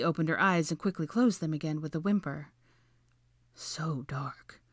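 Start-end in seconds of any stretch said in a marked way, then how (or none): 3.5-4.6 hushed, whispery wonder; awed. A narrator's reading. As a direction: breathe it softly in awe. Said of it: "Draco repeated in awe".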